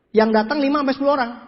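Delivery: normal talking voice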